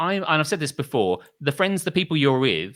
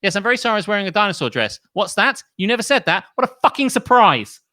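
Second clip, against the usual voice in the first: sarcastic sing-song phone voice, higher and louder; sarcastic fake apology, higher and louder; mock apology call; 7 semitones higher, 5 dB louder than usual. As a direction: ham up a fake-sorry call to the mother.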